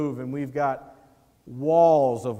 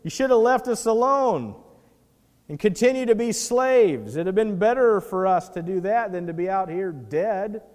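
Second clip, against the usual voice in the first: whiny, raised complaint of the people; griping at Moses about dying here; mock complaining Israelites; 5 semitones higher, 5 dB louder than usual. The people griping at Moses, loud and whiny.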